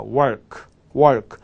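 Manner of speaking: "work" with a rolled r, the tongue not touching the palate; teacher repeats "work" with a curled r. Shown as a demonstration. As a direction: roll the r in "work". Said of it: "이렇게 굴려야 됩니다".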